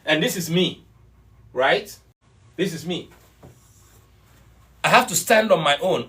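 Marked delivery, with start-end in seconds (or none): none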